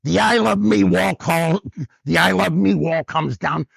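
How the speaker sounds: mock wifely order; imitated bossy wife. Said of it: "She goes".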